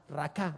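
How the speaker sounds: raká, accented on the last A; raká with end stress, said softly; stress on final A. Shown as a demonstration. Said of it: "con acento en la A".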